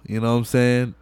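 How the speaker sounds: ordinary talk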